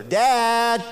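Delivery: calling out. Retold or imitated. Child calling from bed.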